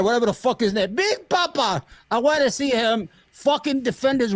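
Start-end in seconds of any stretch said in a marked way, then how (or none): 1.0-1.8 mocking nickname. Real, not acted.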